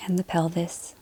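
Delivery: calm body-scan voice, short phrases between long silences; guided meditation. Acted. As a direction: lead them gently through the body, leaving space to breathe.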